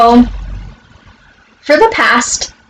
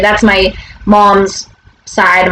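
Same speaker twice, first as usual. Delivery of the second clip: ordinary talk, nothing marked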